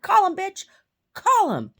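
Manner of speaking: high, loud, daring yell; taunting shout at full pitch; retold defiant shout. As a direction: holler it as a challenge, re-enacting the fight. Shouts own dare from the quarrel.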